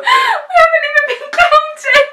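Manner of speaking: squealing protest. Real, not acted. Sister protests in the fight.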